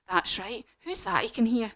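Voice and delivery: ordinary delivery, nothing marked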